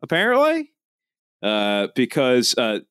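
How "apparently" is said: put-on Australian accent with rising endings; mock Australian accent. Host introduces the caller from Australia, teasing mock Australian accent. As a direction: lift phrase endings in a joking Australian accent. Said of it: "If you do that accent".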